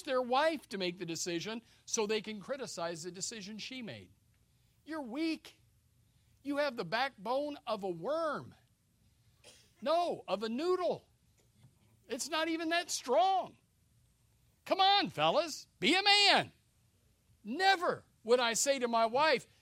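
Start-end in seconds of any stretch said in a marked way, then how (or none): 4.9-16.5 higher, louder scolding of weak men; scornful rebuke. Real, not acted. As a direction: shame the fellas into standing up. Preacher berating weak men.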